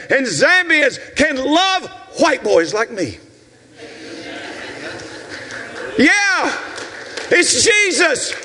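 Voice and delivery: high, shouted pulpit proclamation; impassioned, drawn-out key words; full-force preaching. Real, not acted. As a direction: ring out each phrase like a revival preacher. Preaching climax, proclaiming Jesus.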